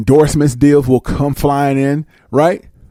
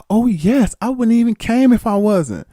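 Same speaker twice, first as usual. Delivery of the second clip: high, eager yes; eager newcomer; 7 semitones higher than usual. Retold or imitated. Newcomer eager to join, high and eager. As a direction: answer too eagerly. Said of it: "She'd be like".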